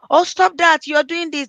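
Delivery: high, loud scolding; mock shouting down. Adult cutting off a child's tantrum, shouting. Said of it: "Don't shut them down".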